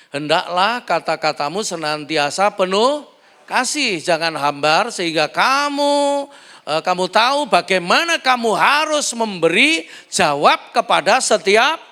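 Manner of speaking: loud, slow, ringing scripture reading; preaching scripture. Acted. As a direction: proclaim the verse to the congregation. Reads scripture at full force. (membaca ayat Kolose dengan lantang seperti berkhotbah)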